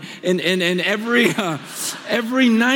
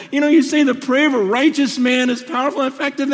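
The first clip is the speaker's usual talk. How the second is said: ordinary delivery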